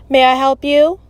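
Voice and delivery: loud official greeting from a speaker box; booming intercom voice. Acted. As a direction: boom it out, high and loud. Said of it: "a woman's voice boomed from the box".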